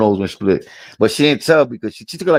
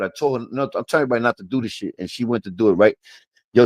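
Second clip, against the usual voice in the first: normal talking voice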